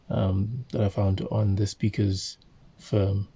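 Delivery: ordinary talk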